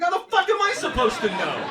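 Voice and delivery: high, loud exasperated outburst; exasperated yell. Retold or imitated. Comic to friends, a high loud exasperated yell. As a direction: yell it in disbelief.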